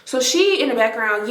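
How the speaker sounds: ordinary delivery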